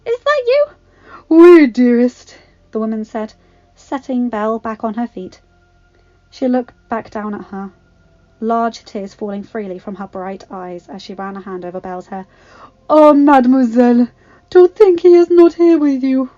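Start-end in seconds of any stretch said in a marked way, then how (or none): 0.1-0.7 very high, astonished question; barely managed. A narrator's reading. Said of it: "she managed". 1.3-2.4 big, booming, slow French endearment; songlike voice. A narrator's reading. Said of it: "Her voice was like a song". 12.9-16.3 loud, high, theatrical lament; tearful grand lady. A narrator's reading. Large woman in tears, mourning someone absent.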